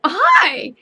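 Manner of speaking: put-on playful hello back to a kid; cheerful greeting back. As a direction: greet the child sweetly. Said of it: "And I go".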